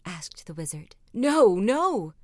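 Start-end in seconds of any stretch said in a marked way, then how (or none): 1.1-2.1 loud anguished no; crying out. Acted. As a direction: cry it out in regret. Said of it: "cried the king".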